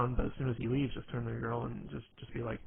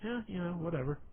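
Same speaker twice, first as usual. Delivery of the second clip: higher-pitched, careless shrug of a reply; indifferent brush-off; 6 semitones higher than usual. Pretend. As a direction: toss it off like you can't be bothered. Acting out not caring about anything.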